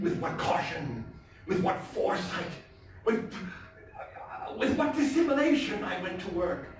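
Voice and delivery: a nervous, wound-up killer confessing in a high, intense voice; tense madman narrator. Acted. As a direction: play the madman insisting he is sane, swinging between hushed and frantic.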